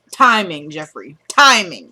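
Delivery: TIMING punched hard, falling away; emphatic retort. Retold or imitated. Retelling Candace's answer to Jeffree, "Timing" punched emphatically. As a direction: hammer each "Timing" as its own verdict. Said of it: "No, she didn't say it like that".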